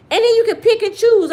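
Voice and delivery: loud and up high; high mocking exclaim. Real, not acted. Mocking brothers who pick women for money, loud. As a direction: ridicule them, voice way up.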